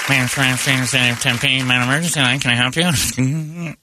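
very slow, droning operator; bored dispatcher. Retold or imitated. Non-emergency dispatcher answering the line, slow bored drone.

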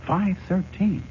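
high, stunned question; stunned disbelief. Acted. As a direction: hit it high in shock. Learns the year is 513.

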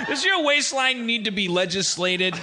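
high-pitched tailor-governor patter; hammed-up salesman. Comic as governor who sells suits, hammed-up high salesman. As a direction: sell it high, like a catchphrase.

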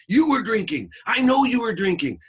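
very high, loud accusing yell; furious accusation. Retold or imitated. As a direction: imitate a raging father. Acted quarrel, father accuses.

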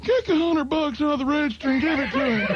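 prank-calling Ronnie in the owner's voice; Joe impression. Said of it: "do this impression so well".

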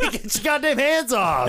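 high, slow, laughing shout; mock yell. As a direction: bark at Hobie to let go, laughing.

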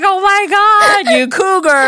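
high, loud, drawn-out exclamation; mock shock. Whole table teases her about being older, mock shock. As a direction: imitate a whole table's scandalised teasing.

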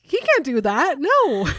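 loud, high, wailing protest; distraught outcry. Acted. Reader acts Bella refusing the news, loud high wail. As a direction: cry it out in hammed-up despair. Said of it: "And then just a scream".

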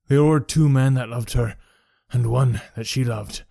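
a lightly voiced bitter old veteran; understated gruff veteran recounting the mutiny; old soldier's tale. A narrator's reading. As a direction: tell it as a broken man remembering. Henry Wood tells his story.

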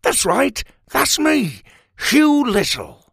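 high, bright self-introduction; happily oblivious little farmer; cheerful proud little man. Acted. Hugh proudly names himself.

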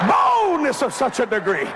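slow, high, drawn-out exclamation; emphatic preaching. Real, not acted. Preacher telling how courage hit him, emphatic. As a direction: stretch out the first word, pitched high.

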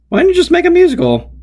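high-pitched, incredulous complaint; exasperated high voice. Retold or imitated. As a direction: squeal it out in disbelief. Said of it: "thinking to myself".

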